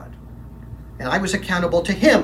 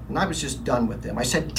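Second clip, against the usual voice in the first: normal talking voice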